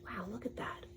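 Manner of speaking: soft amazed whisper; awed whisper. Real, not acted. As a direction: breathe it in wonder. Awed aside to child.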